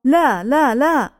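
narrated outcry, pitched high; crying out. A narrator's reading. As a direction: cry out in protest, voice lifted high. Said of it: "صاحت".

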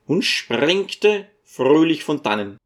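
usual speaking voice

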